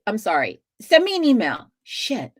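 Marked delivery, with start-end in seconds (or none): 0.8-1.7 calling out. Real, not acted. Calling out to a viewer.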